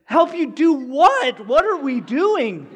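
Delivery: high, loud, drawn-out disbelief; incredulous outburst. Retold or imitated. Storyteller replaying his reply to the pastor, loudly incredulous. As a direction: stretch it out in baffled mock panic.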